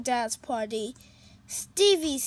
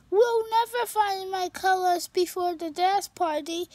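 a touch higher, quick unicorn voice; lightly voiced sad unicorn; 6 semitones higher, faster than usual. Stevie telling Pete about the party, lightly worried.

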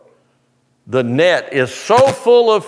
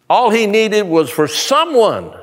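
ordinary talk

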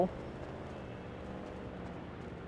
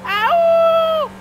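startled yelps; 11 semitones higher, much slower than usual. Real, not acted. Gamer reacting to getting hit in play, startled yelps. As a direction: yelp as if you just got hit. Cries out at hits in the game.

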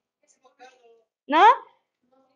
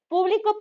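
"público" stressed on PÚ; PÚ-bli-co, accent at the front; stress on first syllable; 6 semitones higher than usual. Shown as a demonstration. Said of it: "conocer los acentos".

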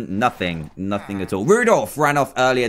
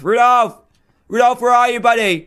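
loud, high call across the stable; 9 semitones higher, 4 dB louder than usual. Real, not acted. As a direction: shout to find a lost friend. Calling out for missing reindeer.

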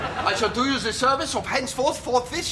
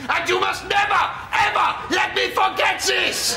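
put-on higher, sudden German-accented shout; hammed-up shouting German; shouted outburst; 6 semitones higher than usual. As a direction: erupt in comic German fervour.

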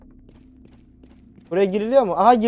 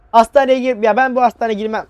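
higher, louder panicked refusal; scared refusal; 3 semitones higher, 6 dB louder than usual. Real, not acted. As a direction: balk at the hospital in real fright. Scared refusal in horror game.

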